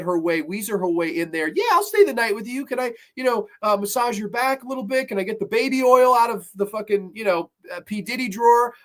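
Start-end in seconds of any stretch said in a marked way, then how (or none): 1.5-8.7 higher, sugary scheming friend voice; sleazy friend. Retold or imitated. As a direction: sweet-talk her, higher than normal. Friend voiced weaseling her way in.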